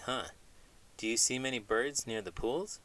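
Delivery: usual speaking voice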